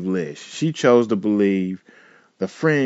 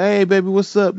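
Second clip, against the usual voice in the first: sweet greeting; 9 semitones higher than usual. Retold or imitated. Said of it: "be like".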